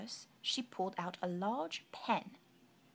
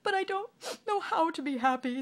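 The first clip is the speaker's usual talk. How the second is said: higher, tearful sniffling king; a sad king sniffling; mild sniffling sulk; sniffly king; 9 semitones higher, 8 dB louder than usual. Acted. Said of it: "sniffed the king".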